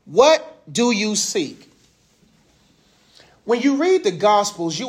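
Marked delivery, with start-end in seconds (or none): 0.0-1.7 each word drawn out slowly; slow deliberate question; slow, weighty question. Shown as a demonstration. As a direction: ask it slow and searching. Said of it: "What's the question he asked".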